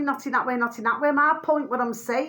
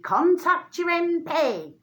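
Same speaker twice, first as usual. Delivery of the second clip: mocking quote; 5 semitones higher, slower than usual. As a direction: parrot the empty advice slowly, in a put-on voice. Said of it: "All you hear".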